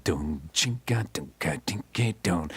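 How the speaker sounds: slow scatted funk bass riff; bass line scat. Retold or imitated. Showing his funky bass part on the Doors demo, scatted slowly. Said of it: "And I'm playing".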